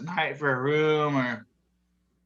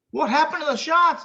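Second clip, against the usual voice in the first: high, loud surprised bartender; astonished bartender; 6 dB louder than usual. Retold or imitated. Bartender shocked at the empty shots, high loud. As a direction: bartender gaping at empty glasses, much higher.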